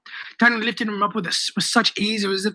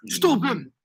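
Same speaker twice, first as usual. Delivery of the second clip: calling for help; 5 semitones higher, 3 dB quieter than usual. A narrator's reading.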